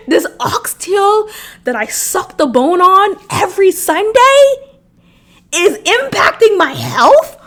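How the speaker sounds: rising shock at her own oxtails; incredulous outburst. Real, not acted. Disbelief at diet news.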